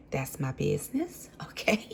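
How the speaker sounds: whispered sassy auntie; Auntie Tab voice. Retold or imitated. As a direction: hush it like a nosy auntie. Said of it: "in my Auntie Tab voice".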